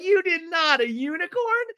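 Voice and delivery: mock-amazed high squeal; very high pitch, playing up the thrill; Brittany. Woman given a unicorn gift, in a hammed-up high squeal. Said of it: "And Brittany goes".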